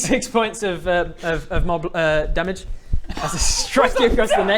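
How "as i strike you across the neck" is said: laughing. Acted. DM narrating kill, laughing.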